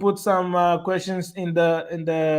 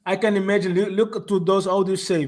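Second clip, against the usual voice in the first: ordinary delivery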